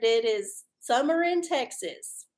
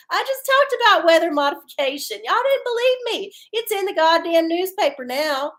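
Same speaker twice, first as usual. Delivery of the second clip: mock told-you-so; 6 semitones higher, 5 dB louder than usual. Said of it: "I was like".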